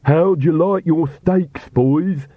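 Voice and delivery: high, drawn-out gleeful call; excited shout. A narrator's reading. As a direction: Igor calling out excitedly as he strikes. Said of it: "he shouted excitedly as he struck".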